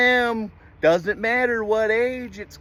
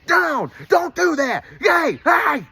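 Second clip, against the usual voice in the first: sharp, higher, louder command to a dog; firm dog command; 6 semitones higher, 4 dB louder than usual. Shown as a demonstration. Showing a dog owner a real command, sharp and loud. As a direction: bark the command, then cheer the dog.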